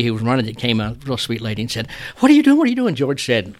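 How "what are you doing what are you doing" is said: high, loud startled shriek; shocked cry. Retold or imitated. Bar lady sees horses coming in, high shocked cry. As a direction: squeal it out in alarm.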